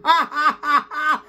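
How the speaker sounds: high, rhythmic forced laughter; demonstrated big laugh. Shown as a demonstration. Teacher demonstrates laughing at the phone, high forced laughter. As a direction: peal of staccato ha-ha laughter.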